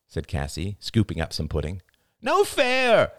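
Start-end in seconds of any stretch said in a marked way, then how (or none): 2.2-3.1 put-on high whiny toddler protest; a small boy's angry complaint, pitched high; angry child. A narrator's reading. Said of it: "cried Ben angrily".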